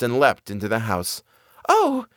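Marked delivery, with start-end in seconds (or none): 1.7-2.1 high delighted exclamation; happy girl. A narrator's reading. Marlene back with her new shoes, high happy cry. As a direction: pitch it way up, pleased. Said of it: "she was contented and happy".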